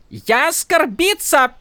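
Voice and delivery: high, slow mock outrage; wailing Horneater accent. A narrator's reading. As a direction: wail in a fake Horneater accent. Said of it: "завопила Шалан".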